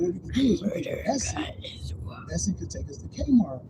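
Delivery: whispered plotting, voice unvoiced; scheming whisper. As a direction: whisper the plan like a conspirator. Boy plotting the theft.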